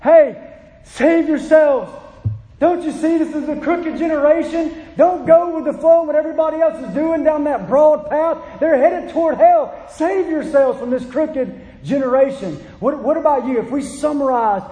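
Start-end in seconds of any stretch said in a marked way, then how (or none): 0.0-0.3 urgent, louder preacher's plea; pleading call. Retold or imitated. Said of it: "That he would look at them and say". 0.8-1.9 higher, louder, slow plea; urgent plea. Retold or imitated. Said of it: "look at them and say". 2.6-12.6 urgent, louder preacher's plea; pleading call. Retold or imitated. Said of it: "That he would look at them and say".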